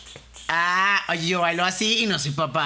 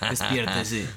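lower, gruff wake-up call; dad waking son; 7 semitones lower, much slower than usual. Retold or imitated. Imitating his father knocking at dawn, a gruff wake-up call. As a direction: bark it through the door like a dad. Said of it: "me cogía la puerta así".

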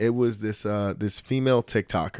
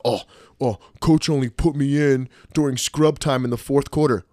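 louder, put-on player's complaint; mock bitching; 3 semitones higher, 4 dB louder than usual. Player griping about scrub time, mock complaint. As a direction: imitate a benched player griping about the coach. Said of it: "Don't bitch about".

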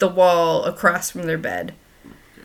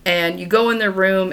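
ordinary talk

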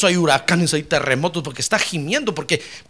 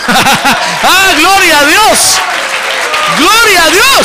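high, loud, slow cry of glory; ecstatic praise shout; 9 semitones higher, 7 dB louder, much slower than usual. Real, not acted. Preacher crying out praise to God before his congregation, ecstatic shout. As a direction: let the praise ring out at full force.

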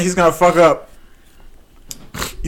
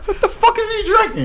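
laughing disbelief. Real, not acted.

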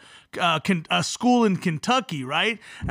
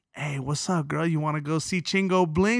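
low, softer casual come-on; smooth pickup; 6 semitones lower, 5 dB quieter than usual. Retold or imitated. Guy asking a girl to a show, suave low voice. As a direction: ask it low and cool.

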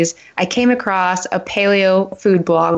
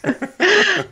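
laughing; 7 semitones higher, slower than usual. Real, not acted. Guest laughing in sympathy.

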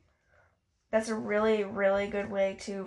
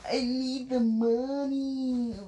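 "I need the money" slowly, in a put-on voice; hammed-up greedy confession; slow mock dramatic; slower than usual. Shown as a demonstration. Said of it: "repeats slowly in put-on voice".

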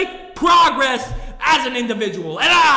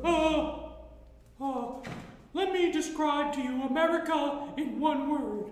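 put-on much softer, mumbling old politician; Sleepy Joe impression; 10 dB quieter than usual. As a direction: fade out like a confused old man. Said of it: "let me do an impression of Sleepy Joe".

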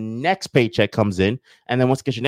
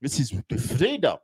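deliberate mock South African statesman; Nelson Mandela. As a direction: stretch the words in a South African impression. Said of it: "that is my Nelson Mandela voice".

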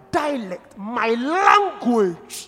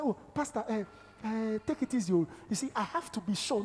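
doubting believer; 8 dB quieter, faster than usual. Retold or imitated. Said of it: "I say".

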